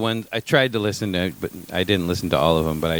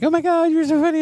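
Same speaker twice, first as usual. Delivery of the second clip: high squealing mock gush; gushing fan voice; 4 dB louder than usual. Mimicking gushing fans.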